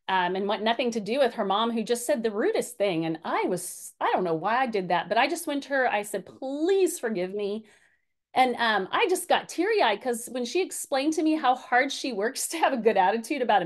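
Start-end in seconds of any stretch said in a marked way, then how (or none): 6.2-7.6 slow, drawn-out "Please"; earnest re-enacted apology, taken slowly; retold plea. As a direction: plead for forgiveness, stretching the first word. Retold pleading apology to her daughter.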